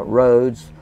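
usual speaking voice

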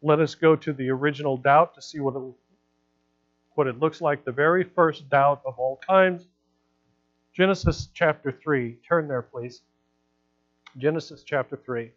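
loud, driving sermon delivery; preaching at full force. Acted. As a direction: preach it out to the whole church. Preaches loudly to congregation.

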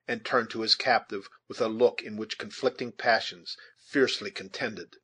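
plain reading voice

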